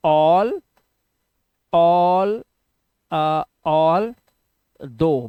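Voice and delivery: all held long and alone, then दो; sounded out slowly. Shown as a demonstration. Teacher repeating it for learners, sounded out slowly. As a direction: stretch all, pause, finish on दो with open o. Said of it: "क्या पढ़ते हैं?".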